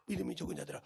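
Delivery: sudden drop to a low, very soft voice; hushed reproach. Acted. Preacher as Jesus sighing over his disciples, hushed reproach. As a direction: let the rebuke sink almost to nothing. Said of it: "내 자식, 내 제자들 보고".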